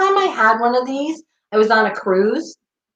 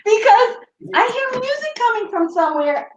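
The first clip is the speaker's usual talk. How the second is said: higher, insisting on being heard; exasperated repeat; 9 semitones higher than usual. Real, not acted. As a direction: say it again, fed up. Repeats herself, nobody listened.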